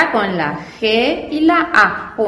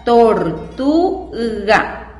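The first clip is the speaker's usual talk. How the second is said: slow reading; much slower than usual. Shown as a demonstration. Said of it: "ahí formamos la palabra".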